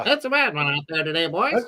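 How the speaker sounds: put-on fast old-timey newsreel announcer, lightly done; 1930s newsreel guy. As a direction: rattle it off like a vintage newsreel. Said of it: "Ed sounds like a nineteen thirties, uh, uh, n-, newsreel guy".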